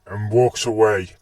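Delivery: ordinary delivery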